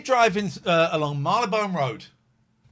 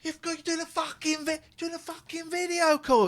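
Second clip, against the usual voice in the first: much higher sweary rebuke; retold telling-off; 13 semitones higher than usual. Driver scolds the van man, high sweary telling-off. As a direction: scold him through the window. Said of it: "Here we go".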